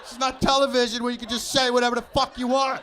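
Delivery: high, loud indignant shouting; mock outraged yell. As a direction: blast it like he's insulted. Yelling at mailman's doubt.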